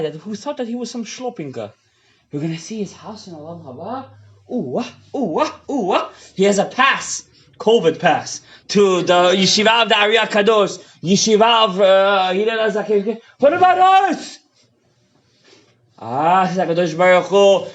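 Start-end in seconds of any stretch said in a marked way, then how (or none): 4.5-6.1 half-sung wail in jest; mock wailing. Mocking wailing sound. 13.4-14.4 high, slow outcry; preacher crying out for the congregation; pleading outcry. Acted. Crying out on behalf of crowd.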